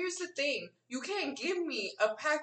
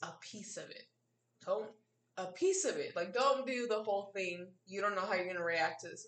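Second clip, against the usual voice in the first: gentle warning; 7 semitones lower, 7 dB quieter than usual. Retold or imitated. Careful friends warning about edibles.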